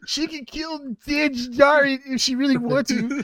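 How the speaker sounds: high, slow put-on fanboy voice; mock nerd. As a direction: argue fan lore in a high nerd voice. Putting on a nerd voice.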